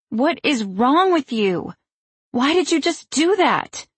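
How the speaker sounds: high, slow and pleading; upset yelling. A narrator's reading. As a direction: cry out in disbelief. Dot fights mother's grip.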